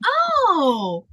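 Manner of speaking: high, drawn-out "oh" of dawning understanding; mock-enlightened, pitched well up; acted realization. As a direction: let it click with a long, falling "oh". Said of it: "I'm like".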